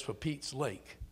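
hushed little cat voice. Retold or imitated. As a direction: play the cat's mangled saying. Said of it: "Tiff's cat says".

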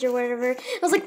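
normal talking voice

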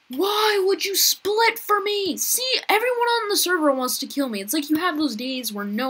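high whiny yell. Real, not acted. Gamer yelling at the player who split. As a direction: wail at the unfair players. Complaining loudly at other players.

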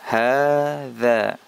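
teacher's clear model of hadza; careful Arabic hadza. Shown as a demonstration. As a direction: model hadza slowly for students, ha-dha. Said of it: "Kita ulangi".

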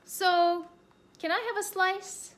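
high, hopeful little ask; bear asking sweetly. Acted. Bear asking rabbit for pizza, high coaxing voice.

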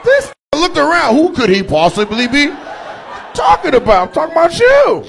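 high, loud, laughing disbelief; laughing loud roast. Real, not acted. Comic yells at the audience guy about his tiny shirt, laughing.

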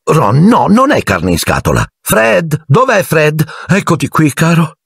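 high-pitched fussing mother voice; Mrs Weasley. Acted. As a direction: bustle about like a busy mum.

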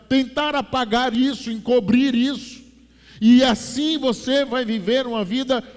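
high, loud preaching at full force; loud sermon voice; fiery preaching. Acted. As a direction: preach it hard to the congregation.

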